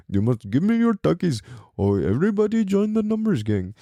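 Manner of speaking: mock Indian-accented prison gang member; a hint of an Indian accent, teasing and demanding.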